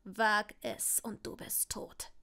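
threat sinking to a whisper; horrified threat. A narrator's reading. Draco warning Longbottom off his wand, horrified. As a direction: let the appalled warning drop away. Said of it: "rief der Slytherin entsetzt".

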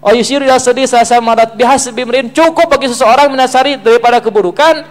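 loud, high sermon recitation; forceful preaching. Real, not acted. Preacher quotes a saying on belittling others, forceful preaching. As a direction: declaim it at full force to the congregation.

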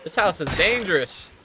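loud exclaim. Real, not acted. Alone in dark house.

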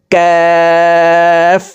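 "কাফ" with the vowel held very long on a high level tone; four-alif stretch. Shown as a demonstration. Teacher demonstrating kaf, long drawn-out hold. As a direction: draw out "কাফ" as one steady chanted note. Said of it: "চার আলিফ টান হবে".

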